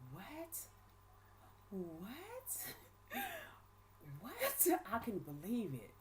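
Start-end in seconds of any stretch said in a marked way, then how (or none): 0.1-4.7 high, hushed, drawn-out "what"; stunned disbelief. Retold or imitated. Woman hearing she is going down too, stunned. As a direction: replay the shock, pitch shooting way up. Retells shock at hearing bad news.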